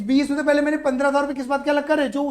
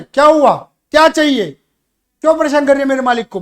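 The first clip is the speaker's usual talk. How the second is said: loud, angry challenge; angry guard; 2 semitones higher, 10 dB louder than usual. Acted. Teacher voicing a protector confronting the widow, loud angry. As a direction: stand between the owner and her, demanding loudly. Defending owner from worker's wife.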